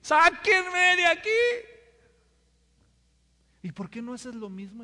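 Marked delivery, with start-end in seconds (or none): 0.0-1.3 high, loud, slow yell for help; mock scream. Man fallen in lion cage screams for rescue, loud. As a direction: scream to be pulled out. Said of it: "yo grito".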